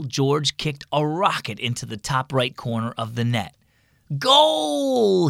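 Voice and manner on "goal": high, loud drawn-out shout; celebrating yell. A narrator's reading. George scores a penalty at practice, triumphant yell. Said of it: "he yelled".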